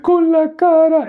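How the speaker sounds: very high, drawn-out mock wail; la Chilindrina crying. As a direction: bawl the answer like a comic little girl. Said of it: "Y ella llorando". (llanto fingido, agudo y alargado)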